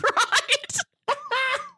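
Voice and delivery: ordinary talk, nothing marked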